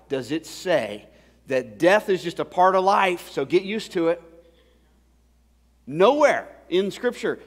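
loud, high preaching with hard-hit key words; impassioned preaching. Real, not acted. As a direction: preach it at full force to the congregation. Preacher builds to full force.

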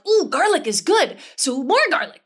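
high, loud burst of delight; hammed-up excitement; over-eager enthusiast. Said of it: "I'm the enthusiast".

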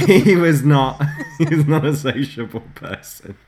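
laughing through the words; giggling as you say it. Real, not acted. Laughing while telling anecdote.

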